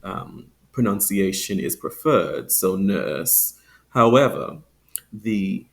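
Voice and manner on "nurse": "nurse" with no R, long uh vowel; non-rhotic. Shown as a demonstration. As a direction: leave the R out of "nurse". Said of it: "non-rhotic".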